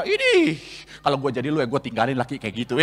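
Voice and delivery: gossipy friend. Acted. Bad friend urging the wife to leave, low sly voice. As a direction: lean in and egg her on.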